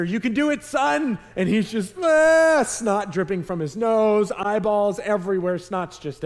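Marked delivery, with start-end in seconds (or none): 0.0-1.2 high, louder cheer to a frightened son; encouraging dad shout. Retold or imitated. 2.0-2.6 high, loud mock scream of a panicking kid; imitated child's scream. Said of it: "he just starts screaming and screaming".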